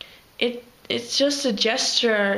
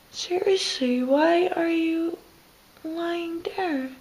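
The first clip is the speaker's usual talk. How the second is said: pet talk; 7 semitones higher, slower than usual. Real, not acted. Talking to the pet bird.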